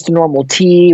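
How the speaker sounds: normal talking voice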